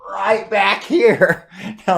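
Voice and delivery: loud, high, talking through laughter; laughing. Real, not acted. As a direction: laugh while getting the words out. Breaks up over silly pronunciation.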